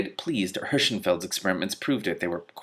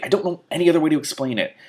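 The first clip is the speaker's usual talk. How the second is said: cross; 8 semitones higher, 7 dB louder, faster than usual. A narrator's reading. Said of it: "said crossly".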